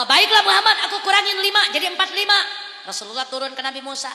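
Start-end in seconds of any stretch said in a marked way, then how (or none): 0.0-2.3 loud, high, laughing reply; amused God. Acted. Allah granting Muhammad's request with a loud laugh. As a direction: grant the bargain with a chuckle. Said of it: "Kata Allah".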